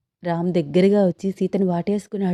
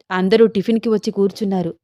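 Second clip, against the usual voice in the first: ordinary delivery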